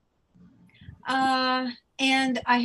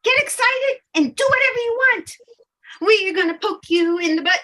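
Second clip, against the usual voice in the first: higher, louder, giddy fairy cheer; cheering fairy guides; 7 semitones higher, 7 dB louder, faster than usual. Acted. Fairy guides urging Leah to take action, loud and giddy. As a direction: hype her up like excited fairy cheerleaders.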